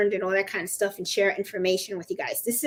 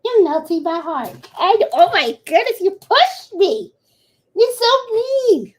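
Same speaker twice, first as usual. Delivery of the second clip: high, loud pet-talk protest; mock hurt scolding; 8 semitones higher, 7 dB louder than usual. Scolding the dog for pushing her, mock hurt. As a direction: complain in an exaggerated high voice, stretching the last words.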